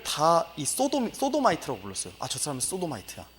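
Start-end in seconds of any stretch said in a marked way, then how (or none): 2.2-3.2 quiet mock gossip. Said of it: "이렇게 불렀다는 겁니다".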